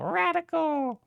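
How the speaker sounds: radical said very high and squeaky, falling. Shown as a demonstration. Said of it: "repeated with contrasting delivery".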